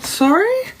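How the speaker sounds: high, startled "Sorry?"; a sharp, incredulous question; shocked disbelief. Retold or imitated. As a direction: recoil in disbelief at the news. Hearing sister accused of drugs.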